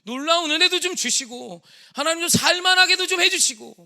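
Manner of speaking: high, loud wailing plea to God; anguished outcry. Retold or imitated. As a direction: build from protest to full-voiced screaming prayer. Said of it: "절규를 했어요".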